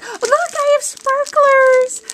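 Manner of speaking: high, loud squeal of joy; excited squeal. Real, not acted. Finding sparklers in the gift box, a loud high squeal.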